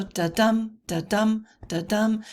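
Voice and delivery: "da-dum" repeated evenly, weight on each "dum"; heartbeat rhythm. Shown as a demonstration. Teacher demonstrating a heartbeat, "da-dum" stressed on "dum". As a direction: beat out "da-dum" like a steady heartbeat. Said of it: "it's similar to a heartbeat".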